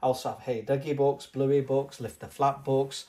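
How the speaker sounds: usual speaking voice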